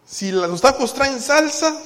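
high, loud whiny complaint; mock complaint. Preacher mocking picky church members, loud high whine. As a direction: whine loudly about the tacos.

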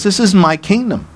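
normal talking voice

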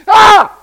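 short, loud, high yelp; sudden shriek of alarm, acted out; startled scream. Commander grabs the bag and it moves, startled scream.

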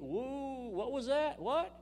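high, delighted exclamation; high astonished whoop; surprised. Real, not acted. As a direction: let the verse startle you. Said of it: "reacting to his own verse".

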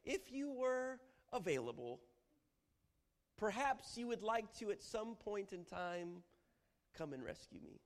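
high, slow, timid request; weaker, polite plea. Acted. Preacher acting a meek, overly polite prayer. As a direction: ask ever so hesitantly. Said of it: "a weaker, uh, position".

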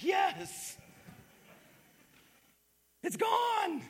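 high, delighted mock cheer; hammed-up relief that the complainer is out; office cheer. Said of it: "everybody in the office is like".

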